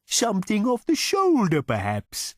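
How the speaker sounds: very high, courteous cow voice; a cow recommending its own cut; suggestive cow. A narrator's reading. Cow suggesting a cut to diners, in a very high courteous voice. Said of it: "suggested the animal".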